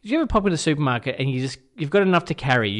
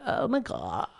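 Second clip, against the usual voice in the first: put-on valley girl; 8 semitones lower, 6 dB quieter, slower than usual. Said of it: "she sings like a valley girl".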